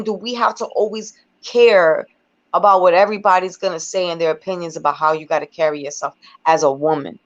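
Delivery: usual speaking voice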